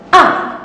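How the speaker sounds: high, sharp falling "A!" like being hit; sharp falling cry. Shown as a demonstration. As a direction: cry "A!" as if struck, dropping fast. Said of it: "Kamu harus bayangin kamu dipukul atau kamu jatuh". (seruan "A!" tinggi lalu turun tajam)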